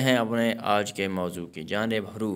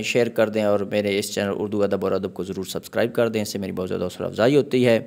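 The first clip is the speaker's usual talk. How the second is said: louder, fast appeal to like and subscribe; upbeat channel outro; 6 dB louder than usual. Acted. Teacher asking viewers to like, share and subscribe, louder and fast. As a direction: push the viewers warmly to subscribe.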